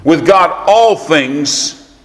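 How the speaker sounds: high, ringing, drawn-out pulpit emphasis; forceful preaching. Real, not acted. Preacher assuring folks of what God can do, forceful and high. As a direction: preach it with weight, stretching key words.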